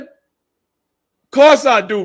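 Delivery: slow, high, loud and stretched; milk every word of it; cocky stretched brag; drawn-out boast. Real, not acted.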